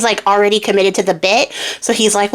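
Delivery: ordinary delivery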